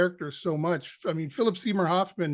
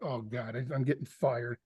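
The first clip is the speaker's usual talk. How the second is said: much lower, much softer panicked aside; hushed dread; 6 semitones lower, 7 dB quieter than usual. Retold or imitated. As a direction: mutter it to yourself in dread. Inner panic, expecting firing.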